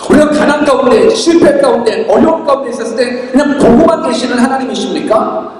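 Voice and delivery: ordinary delivery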